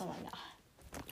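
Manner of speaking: unvoiced disgusted mutter; muttered under breath. Real, not acted. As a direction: whisper it in disbelief. Sibling has jawbreaker in mouth.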